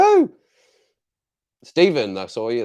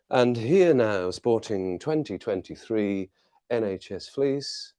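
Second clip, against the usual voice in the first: mock presenter intro; 4 dB quieter, slower than usual. Mock introduction of himself in fleece.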